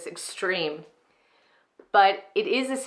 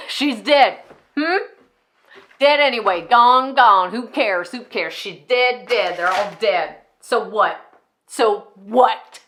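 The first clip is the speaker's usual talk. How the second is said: loud, punched repetitions; furious dismissal; 2 semitones higher, 8 dB louder than usual. Acted. Enid shrugs off Mama's death, loudly defiant. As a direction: hammer each word as if you don't care. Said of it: "how insane they can sound at times".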